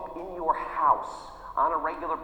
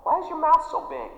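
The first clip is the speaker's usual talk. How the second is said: mock critic; 11 semitones higher, 6 dB louder than usual. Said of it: "Some people might say".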